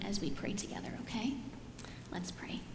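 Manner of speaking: whispered invitation, hushed. Real, not acted. Pastor inviting the children to pray, whispering. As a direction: drop to a hush before the prayer. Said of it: "whispered invitation to pray".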